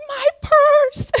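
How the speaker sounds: high, loud cry of delight; overjoyed exclamation. Retold or imitated. Shopper sees her lost purse at the counter, overjoyed shout. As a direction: burst out in joyful recognition, reliving it. Retold joy at finding purse.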